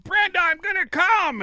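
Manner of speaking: high, loud, drawn-out heckle; imitated yelling. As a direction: yell it slowly from the back of the room. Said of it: "this guy started yelling in the back".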